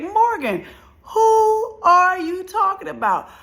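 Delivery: high, loud, drawn-out demand; incredulous question. Retold or imitated. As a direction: mimic a baffled listener, stretching each word high. Voicing listeners who interrupt her.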